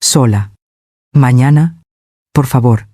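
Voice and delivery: a flat order that allows no reply; firm, no discussion. A narrator's reading. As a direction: shut her down with one word. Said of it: "mi voz no admitía discusión".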